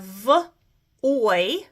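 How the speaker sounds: short isolated "v", pause, then "oy"; sounded out. Shown as a demonstration. Teacher breaking first syllable of voyage apart, sounded out. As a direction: split "v" from "oy" as separate sounds. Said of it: "and I have".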